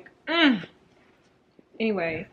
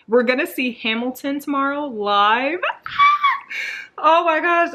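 loud, higher, laughing with joy; excited laughing shout; 5 semitones higher, 6 dB louder than usual. Real, not acted. Telling viewers she'll see Hamilton live, thrilled laughing shout. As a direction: burst out with delight and laugh.

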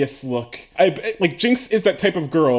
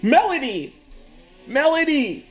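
calling out; 8 semitones higher than usual. Real, not acted. Calling out to neighbour mowing.

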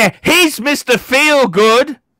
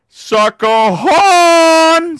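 long drawn-out shout on HORN; calling out; 5 semitones higher, 3 dB louder, much slower than usual. Real, not acted. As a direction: bellow it high, stretching the last word. Calls out for the horn.

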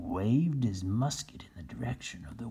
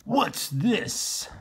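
Captain Bill; 12 semitones higher than usual. Acted. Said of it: "said Captain Bill".

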